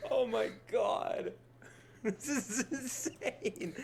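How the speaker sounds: high shout breaking into slow laughter; stunned laughing shout. Real, not acted. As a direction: yell it, then laugh in disbelief. Player stunned by final boss.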